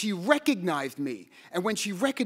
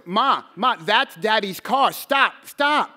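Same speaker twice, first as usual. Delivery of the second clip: put-on urgent raised call of a boy in the car; child calling out; 3 semitones higher, 7 dB louder, slower than usual. Boy to his mother in the car, urgent louder call. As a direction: shout to make mother stop.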